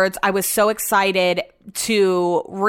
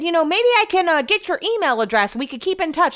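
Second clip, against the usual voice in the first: higher, overly friendly caller; sweet-talking her like the caller did; creepy friendly caller; 8 semitones higher than usual. Retold or imitated. Imitating the caller fishing for her email, sugary.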